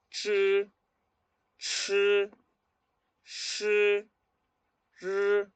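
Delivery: "ch", "ch", "sh", "r" high and level, spaced out; model pronunciation. Shown as a demonstration. Teacher asking learners to repeat along, model "ch, ch, sh, r". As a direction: retroflex drill "ch, ch, sh, r" with room to echo. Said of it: "Repitan conmigo".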